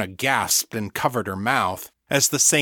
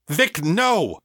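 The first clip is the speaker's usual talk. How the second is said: high, urgent shout of protest; 7 semitones higher than usual. A narrator's reading. Egan to his friend attacking the woman, shouted. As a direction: cry out to stop a friend. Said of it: "Egan shouted".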